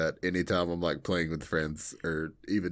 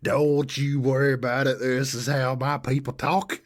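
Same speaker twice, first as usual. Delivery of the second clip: put-on thick Southern accent; 5 semitones higher, 4 dB louder than usual. Showing off how her Southern people talk, thick Southern accent. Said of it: "throw on the accent real thick".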